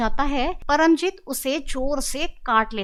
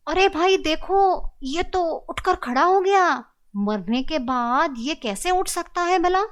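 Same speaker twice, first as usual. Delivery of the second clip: startled; 6 semitones higher, 3 dB louder than usual. A narrator's reading. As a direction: gasp it out in shock. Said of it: "Sujit चौंक कर उसे देखता है". (चौंककर ऊँची आवाज़ में)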